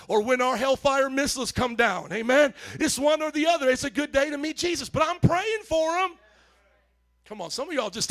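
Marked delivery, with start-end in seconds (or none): 0.1-6.1 higher, louder preaching build; rising preaching. Real, not acted. Pastor preaches to the church, louder and higher. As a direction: drive it up like a revival preacher. Preaching climax about enemies.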